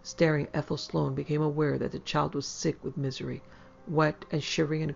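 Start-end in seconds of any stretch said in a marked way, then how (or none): none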